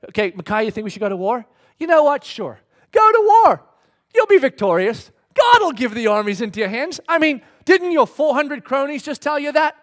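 mock-agreeable sarcasm, rising to loud, high bursts; exaggerated sarcastic yes-man. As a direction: play it up like a sneering prophet. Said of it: "Micaiah was being sarcastic".

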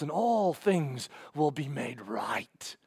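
slow whispered ending; hushed promise. Acted. Preacher promising all will be restored, slow and hushed. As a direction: let it sink into a slow hush.

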